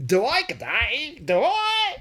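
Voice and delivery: high, loud, slow mock Aussie twang; mock Australian. Mocking his own accent to his wife, hammed-up Aussie. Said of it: "You make fun of my voice".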